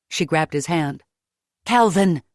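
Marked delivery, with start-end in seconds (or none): 1.6-2.2 louder desperate cry of a name; urgent call. Acted. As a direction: call him back, louder. Leah calls unresponsive Calvin.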